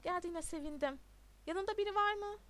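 usual speaking voice

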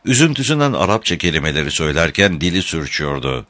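ordinary delivery

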